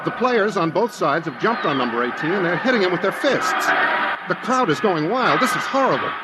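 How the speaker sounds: high, urgent play-by-play, climbing in alarm; an announcer losing his composure as the crowd attacks; horrified commentary. Acted. Johnny Scott describing the mob beating number eighteen, horrified and urgent. Broadcast turns to horror.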